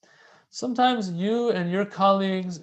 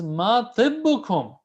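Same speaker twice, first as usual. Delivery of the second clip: slow pleading question; 6 semitones higher, slower than usual. Acted. Quoting Imam, slow and high.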